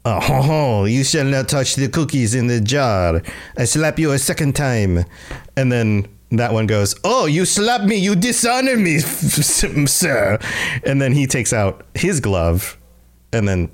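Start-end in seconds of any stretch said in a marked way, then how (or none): 0.1-5.0 hammed-up haughty Frenchman with a French accent; mock French duellist, French accent. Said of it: "like, two Frenchmen with their gloves". 7.0-10.4 high-pitched, affronted mock Frenchman with French accent. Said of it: "like, two Frenchmen with their gloves".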